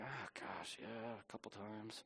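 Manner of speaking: very quiet, low reluctant confession; sheepish admission. Acted. Pastor admitting deadpan slips to elder chairman, sheepish. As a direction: own up slowly, almost under your breath.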